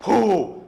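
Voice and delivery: a loud high puff of breath; mock blow. Constable showing the driver how to blow, loud puff. Cop demonstrates blowing.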